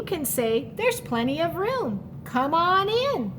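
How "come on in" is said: normal talking voice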